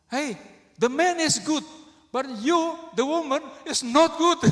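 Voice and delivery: high, loud scolding in English; retold shout. Angry driver to couple in the car, high retold shout. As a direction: re-enact an indignant high-pitched yell. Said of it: "Saya teriakin gitu".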